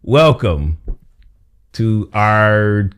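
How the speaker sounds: drawn-out, slow host welcome; game show voice. Shown as a demonstration. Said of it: "it's my game show voice".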